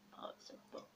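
soft unvoiced whisper. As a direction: whisper it like ASMR. ASMR librarian roleplay, whispered repeat.

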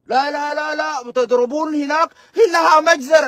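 mock outcry. Mocking critics crying massacre.

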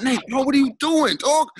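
high, loud alarmed shout at a friend; panicked yell. Retold or imitated. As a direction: yell at your buddy in retold panic. Retold panic at friend's stunt.

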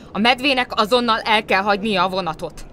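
outraged order. A narrator's reading. Conductor throwing the bear off the train, outraged.

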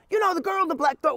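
high, pressing mock voice; imitating the caller. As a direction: push nervously for the name.

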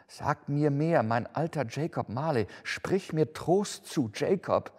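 high, imploring voice; pleading. A narrator's reading. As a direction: beg an old friend for comfort, slowing toward the end. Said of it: "rief er flehend". (flehend, mit erhöhter Stimme)

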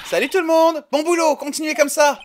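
encouragements criés très aigus; lance-le haut et fort à toute l'équipe; salut tonitruant. Acted. Personnage interpelle toute une équipe. (salutation joviale criée, voix perchée)

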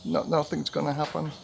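a hint of a girl crying over getting sacked; imitating tears. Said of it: "She burst into tears".

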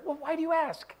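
high, put-on defensive retort; mock indignant; defensive whine. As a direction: whine it back. Acted fake cheer in dialogue.

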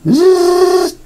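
a sharp, high zipping buzz; mock high buzz of a fast particle; high buzzing zzz. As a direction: buzz like something whizzing past. Imitating fast green particle.

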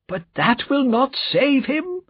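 high, slow cry of grief; an old friend crying out that nothing can save him; sad cry. A narrator's reading. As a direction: let the voice lift and break, slowly and sadly. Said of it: "cried Mister Lorry sadly".

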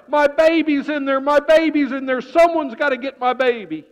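mother begging at the burning building, frantic; frantic cry. Acted. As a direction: plead in panic.

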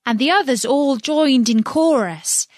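ordinary delivery, nothing marked

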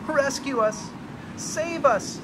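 very high, loud shriek. Acted. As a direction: shriek for rescue in terror. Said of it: "they shrieked".

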